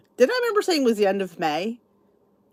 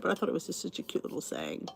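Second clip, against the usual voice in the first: ordinary delivery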